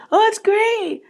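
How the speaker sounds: squeaky high fake-enthused falsetto; fake praise. Woman faking praise for her lover, high and sugary. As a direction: gush way up high, clearly faking it. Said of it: "she goes".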